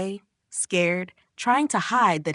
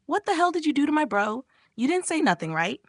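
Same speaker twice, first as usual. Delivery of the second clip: higher, indignant demand; accusing yell; 8 semitones higher than usual. A narrator's reading. Bomb yells at her friend over her brother. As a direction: confront her about her brother. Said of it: "her friend yelling".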